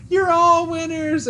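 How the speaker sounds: high, loud cheering shout; yelling. Retold or imitated. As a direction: cheer the racers from the sidelines.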